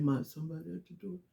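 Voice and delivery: mother copying her son's low, soft mumble. Retold or imitated. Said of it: "And he talks really low".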